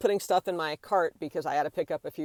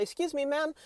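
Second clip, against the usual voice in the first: higher-pitched, courteous staff request; polite approach; 4 semitones higher than usual. Shown as a demonstration. Store worker asks a customer to stop filming, polite. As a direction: demonstrate a tactful, firm request. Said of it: "I would approach someone".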